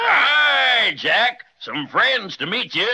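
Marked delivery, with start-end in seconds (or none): 0.0-1.4 a loud, high hail; calling out. Acted. Santa hails his friend Jack as he arrives, calling loudly. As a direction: call out a greeting from afar.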